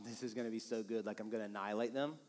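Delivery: hushed, gloating inner thought; a low, muttered scheming thought; quiet inner voice. Retold or imitated. Husband coaching couples, voicing a gloating inner thought, hushed. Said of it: "If you think to yourself".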